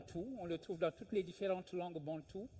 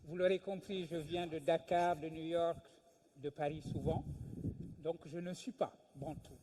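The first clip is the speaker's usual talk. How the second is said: ordinary talk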